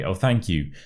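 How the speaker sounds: ordinary talk, nothing marked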